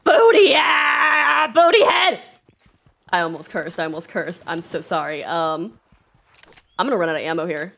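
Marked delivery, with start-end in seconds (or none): 0.0-2.2 loud, high, slow outburst; whiny high frustrated shout; frustrated yell. Real, not acted. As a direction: shout at the enemy who got you. Frustrated at enemy, almost cursed.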